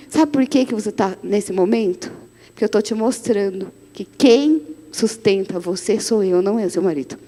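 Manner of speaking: low and soft, God gently correcting; calm voice of God. Retold or imitated. God reassuring the pastor, quiet and low.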